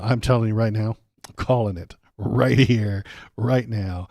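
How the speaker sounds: loud, insistent declaration; emphatic prediction. Real, not acted. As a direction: call it out loudly and firmly.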